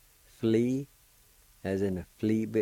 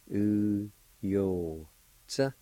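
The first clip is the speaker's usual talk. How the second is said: U, yo, tsa, drawn out with gaps; syllable by syllable; much slower than usual. Shown as a demonstration. Teacher repeating the word for flaw, syllable by syllable. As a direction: separate each syllable of "U, yo, tsa". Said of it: "It's pronounced like this".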